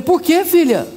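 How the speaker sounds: high, mock-dismayed question; lightly hammed-up bewildered concern. Pastor asking a divorcing woman why, dismayed.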